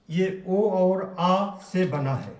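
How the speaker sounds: ordinary talk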